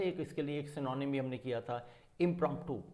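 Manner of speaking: usual speaking voice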